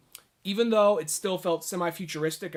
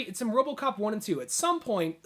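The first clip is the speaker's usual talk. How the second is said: ordinary talk, nothing marked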